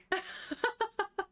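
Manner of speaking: high, breaking-up giggles; real burst of laughter; helpless laughter. Real, not acted. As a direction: crack up at the story. Reader cracks up at story.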